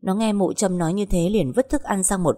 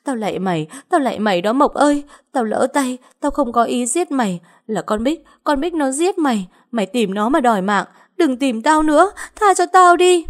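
acted hysterical plea, very high pitch; frantic begging; 7 semitones higher than usual. A narrator's reading. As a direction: beg a ghost for your life. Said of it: "quỳ lạy rối rít".

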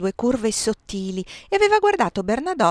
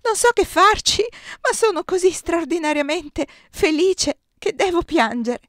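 much higher, louder, tearful joy; laugh-cry from overwhelming happiness; happy sobbing; 9 semitones higher, 5 dB louder than usual. A narrator's reading. Désirée confessing joy to Giulia, sobbing happily.

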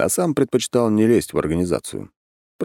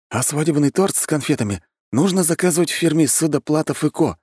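high-pitched voice of an eager explainer; Anton explaining; 9 semitones higher than usual. Acted. Anton advising the groom on his wedding, high explaining voice. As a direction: explain it in a raised character voice.

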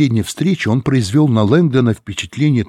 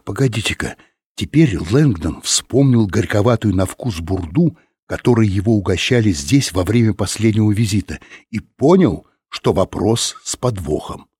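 plain reading voice

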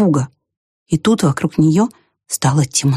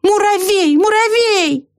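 high, drawn-out calling cry; much slower than usual. A narrator's reading. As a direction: hold the last syllable high, calling. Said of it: "снова закричала белка".

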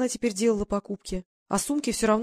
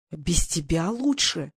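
slow, disbelieving echo; a mild mock outrage, drawn out; incredulous exclamation; 2 semitones lower, much slower than usual. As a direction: repeat her words as if absurd. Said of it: "воскликнул он".

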